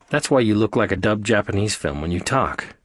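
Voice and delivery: ordinary delivery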